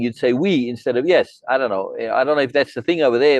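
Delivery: ordinary talk, nothing marked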